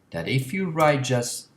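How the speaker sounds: ordinary talk, nothing marked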